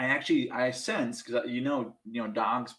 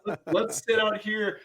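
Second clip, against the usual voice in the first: fake cheerful; 9 semitones higher, 4 dB louder than usual. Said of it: "It's like".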